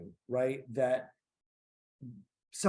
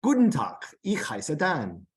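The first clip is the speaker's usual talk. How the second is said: put-on German accent; 5 dB louder than usual. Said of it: "enough with the funny accents".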